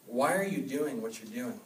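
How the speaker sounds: ordinary talk, nothing marked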